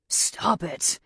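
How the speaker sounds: a touch of anger; mildly irritated order; angry. Acted. Captor ordering struggling victim to quit, mildly angry. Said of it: "he says angrily".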